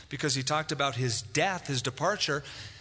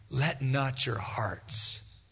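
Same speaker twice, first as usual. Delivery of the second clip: tender reassurance; 3 semitones lower, much slower than usual. Acted. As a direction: soothe them like a troubled friend. Preacher voicing Jesus' comfort.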